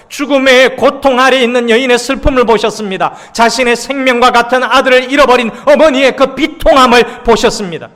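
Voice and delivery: loud, high preaching voice; full-force preaching. Acted. Pastor preaching to the congregation, loud and fervent.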